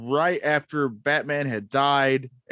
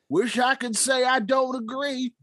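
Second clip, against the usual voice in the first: put-on old prospector; 10 semitones higher than usual. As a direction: play Gordon as a grizzled old prospector. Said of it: "the part of Gordon will be played by the old prospector".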